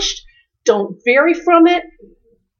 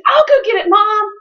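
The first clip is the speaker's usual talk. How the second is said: put-on lifted high, bright eager kid voice; eager little boy; 9 semitones higher, 3 dB louder than usual. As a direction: play the helpful son jumping in. Eager son voiced by mom.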